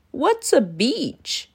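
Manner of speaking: slow, puzzled dinosaur voice, a bit further off; the dinosaur asks, slowly wondering; curious dinosaur. Acted. Dinosaur asking Danny about the beach, slow and puzzled.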